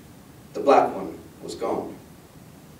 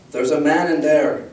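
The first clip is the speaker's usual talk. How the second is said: a firm, raised-voice warning; insistent warning; 3 semitones higher, 4 dB louder than usual. A narrator's reading. Girl warning father who pushed her away, insistent. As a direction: press the warning on a listener who won't hear.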